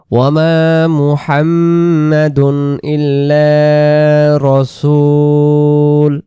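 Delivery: slow, drawn-out melodic tilawat; Quran recitation. Shown as a demonstration. Teacher reciting the verse to students, in a slow melodic chant. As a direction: chant the verse slowly for the class.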